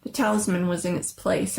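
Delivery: plain reading voice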